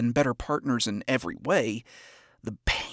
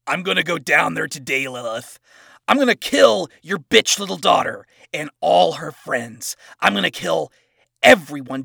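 loud, raging, higher threats; a vengeful angel bellowing his threats; furious threat; 3 semitones higher, 8 dB louder than usual. Acted. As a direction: roar your threats at her. Adam threatens Lilith.

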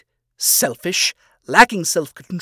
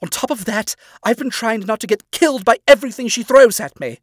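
higher and louder, building to a furious rant; angry father; 6 semitones higher, 4 dB louder than usual. Acted. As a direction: let the rage climb with every clause. Stolas angry tirade.

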